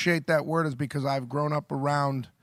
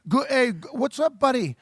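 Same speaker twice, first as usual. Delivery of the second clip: higher-pitched, friendly Welsh greeting; Welsh accent; 7 semitones higher than usual. Acted. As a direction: imitate a chummy guy from Wales. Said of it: "a guy from Wales could be like".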